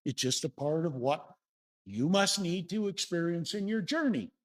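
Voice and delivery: ordinary talk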